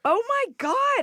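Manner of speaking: amazed. Real, not acted.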